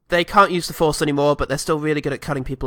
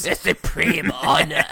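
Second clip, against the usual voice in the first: slow words through laughter; chuckling villain; laughing mock Palpatine; slower than usual. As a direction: savour it, laughing at yourself.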